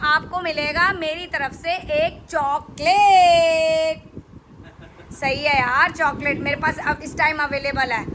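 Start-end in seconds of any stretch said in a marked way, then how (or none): 2.0-4.1 slow, teasing announcement of the prize; drawn-out prize reveal. Real, not acted. Host reveals the winner's prize, drawn-out announcement. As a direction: stretch out the last word long.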